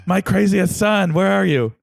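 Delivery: high, loud mock stage whisper, projected far. Zeus calls to his son across Olympus, loud mock whisper. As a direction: call out as a hammed-up whisper that is really a shout. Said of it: "he sort of whispers on Mount Olympus".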